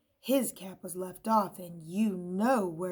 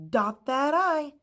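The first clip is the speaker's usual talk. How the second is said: bossy instruction; 7 semitones higher, 9 dB louder than usual. A narrator's reading. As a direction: lecture it firmly. Scissors instructs.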